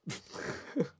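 breathy laugh. Real, not acted. Laugh after the joke.

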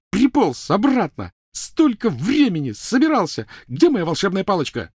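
enraged, high-pitched tirade; furious scolding. Acted. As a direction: tear into him, stretching the words. Hermione attacking Ron in rage.

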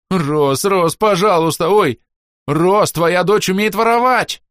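high loud desperate wail; frantic plea. A narrator's reading. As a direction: whine and tattle in panic. Whisper begging then tattling.

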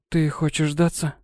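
weak, slurred, disbelieving question; barely moving tongue. A narrator's reading. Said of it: "Еле ворочая языком, недоверчиво выдавила".

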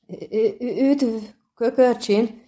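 frightened halfling, slow stutter on the greeting; stammering. Acted. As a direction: stumble over the first sound in fear.

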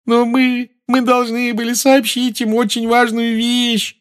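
high, wailing fake cry. A narrator's reading. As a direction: howl it out tearfully. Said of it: "завывала Гермиона". (завывая)

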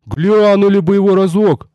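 high, pleading call; hammed-up imploring cry; sentry's wail. A narrator's reading. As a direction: beg from afar in a put-on wail. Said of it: "завопил дозорный".

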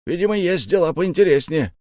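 high, emphatic grumbling voice; complaining shorty. A narrator's reading. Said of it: "пожаловался коротышка".

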